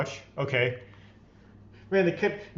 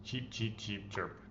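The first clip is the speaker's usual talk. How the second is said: much softer, sheepish little peeps; soft apologetic chirping; apologetic chick peeps; 3 semitones lower, 12 dB quieter, slower than usual. Pretend. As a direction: say sorry as a timid baby chick. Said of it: "What I said just now is "I'm sorry" in chick language".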